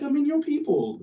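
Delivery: high-pitched imitation of an Oxford don; British Oxford accent. British professor probing a student's family origins, put-on Oxford accent. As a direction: press the point in a mock British accent. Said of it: "this is my best British Oxford accent".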